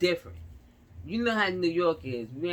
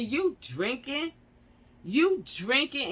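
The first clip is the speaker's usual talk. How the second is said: high, slow, drawn-out accusing question; incredulous questioning; 8 semitones higher, slower than usual. Retold or imitated. Driver asks officer about alcohol, incredulous. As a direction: stretch the question out in disbelief.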